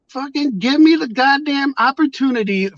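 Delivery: high, strained retold shout; exasperated yell. Yelling at the police negotiator, exasperated. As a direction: throw it at them in frustration.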